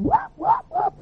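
very high falsetto yelps; short burst of mimicked joyful whooping; imitated convert's whoops. New convert shouting over the phone, imitated high whoops. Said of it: "New convert going".

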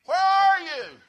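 very loud, high holler; hammed-up searching shout; calling out. Wife voiced searching for him.

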